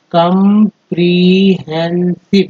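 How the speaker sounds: Comprehensive drawn out slowly, part by part; slow syllable-by-syllable. Shown as a demonstration. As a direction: stretch comprehensive into its parts. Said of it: "चार parts एक word बना है". (धीरे-धीरे टुकड़ों में comprehensive)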